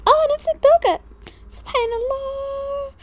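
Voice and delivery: put-on high, slow wondering girl; drawn-out amazed girlish voice. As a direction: marvel at it like a small child.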